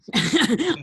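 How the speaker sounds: high loud laugh; burst of laughter. Real, not acted. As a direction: let a real laugh burst out. Laughing at her own joke.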